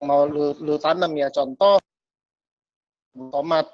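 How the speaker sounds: ordinary talk, nothing marked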